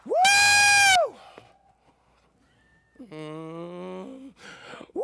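ecstatic high whooping shout; imitating a wild racetrack fan's screaming woo. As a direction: whoop like a shirtless race fan cheering his driver. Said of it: "and he'd go".

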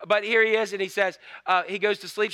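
ordinary talk, nothing marked

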